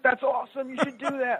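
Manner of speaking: high, playful mock British accent imitating a friend. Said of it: "I'm not gonna pretend to do a British accent".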